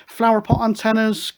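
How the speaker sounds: usual speaking voice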